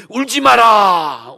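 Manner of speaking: loud, slow plea to stop crying; elder's stern call. Acted. Elder calling loudly to weeping John, slow. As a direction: call it out slowly so his weeping stops.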